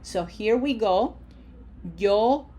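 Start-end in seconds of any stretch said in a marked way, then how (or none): 2.0-2.5 lengthened vowels. Shown as a demonstration. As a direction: draw out each vowel for the learner. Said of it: "Say it just like that".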